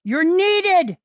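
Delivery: high, very loud call; full-force battle cry; dramatic summons. Acted. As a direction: shout to summon someone who is far gone. Character calls Victor in battle.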